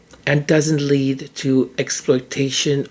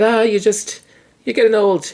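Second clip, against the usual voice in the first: dismissive doctor; 6 semitones higher than usual. Retold or imitated.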